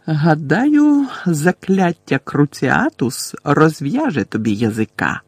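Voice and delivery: usual speaking voice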